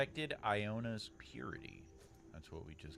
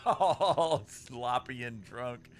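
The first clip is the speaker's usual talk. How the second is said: laughing; 6 dB louder, slower than usual. Real, not acted. Laughing at hungover character.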